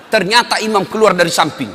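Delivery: ordinary talk, nothing marked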